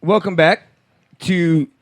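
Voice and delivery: normal talking voice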